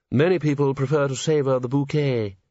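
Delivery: a touch of restrained horror; quietly horrified. A narrator's reading. Host corrects a guest's drinking manners, quietly horrified. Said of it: "said a quietly horrified chair".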